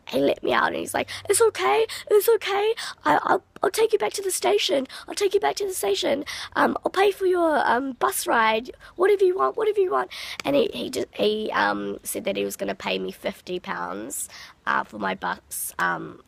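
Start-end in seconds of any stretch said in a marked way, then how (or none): 1.3-10.1 high, agitated, placating voice; imitating his panic. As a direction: mimic a man frantically appeasing someone. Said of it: "he started freaking out himself".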